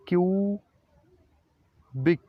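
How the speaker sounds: क्यूबिक drawn out very slowly, kyu-bik; slow pronunciation demo. Shown as a demonstration. Teacher modelling a new word for students, very slow. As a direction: stretch क्यूबिक syllable by syllable. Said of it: "कैसे pronounce करते हैं".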